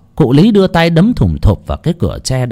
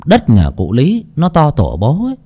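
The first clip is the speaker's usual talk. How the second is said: ordinary delivery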